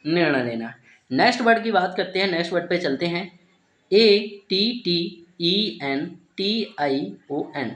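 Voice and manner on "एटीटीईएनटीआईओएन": slow letter-by-letter spelling of डीईसीआईएसआईओएन. Shown as a demonstration. Teacher spelling the word for students, slow and letter by letter. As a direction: spell each letter out slowly, one by one. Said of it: "इसे क्या पढ़ेंगे".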